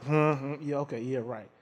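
low, fading, dismissive hmm; dismissive murmur. Retold or imitated. Father brushing off daughter's dog request, dismissive murmur. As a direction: brush her off under your breath.

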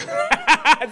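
real amused chuckle; short burst of laughter. Real, not acted. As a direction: laugh it off between remarks. Laughs after joking about closing.

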